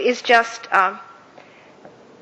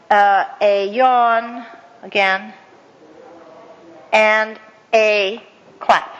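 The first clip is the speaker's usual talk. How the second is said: slow reading out; 3 semitones lower, much slower than usual. Shown as a demonstration. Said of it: "finish this off with".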